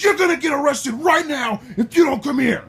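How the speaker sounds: high, loud imitation of an irate cop; sustained high-pitched shouting; screamed threat. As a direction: holler the whole threat at full force. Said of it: "He's screaming at me".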